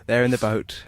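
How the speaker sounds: measured dramatic narration; radio narrator. A narrator's reading. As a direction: close the tale like a radio narrator. Closing narrator of sketch.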